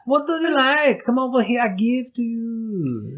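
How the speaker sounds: high, drawn-out mock Jamaican greeting; hammed-up Jamaican islander, pitched high and slow; bad Jamaican accent. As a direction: put on a terrible Jamaican accent. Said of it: "That's the worst Jamaican accent ever".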